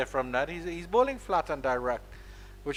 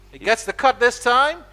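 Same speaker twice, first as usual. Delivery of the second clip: rising to the shot, higher and louder; excited commentary call; 7 semitones higher, 9 dB louder than usual. Real, not acted. Commentator calling the shot to viewers, higher and louder. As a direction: call the cut as it races off.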